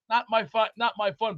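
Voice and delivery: ordinary talk, nothing marked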